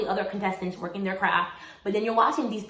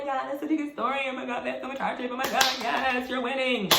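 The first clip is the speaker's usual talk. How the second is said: higher, mock-sympathetic fan voice; gushing TV viewers; 3 semitones higher, 4 dB quieter than usual. TV viewers reacting to a hardship story, mock gushing. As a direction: imitate viewers gushing over a sob story.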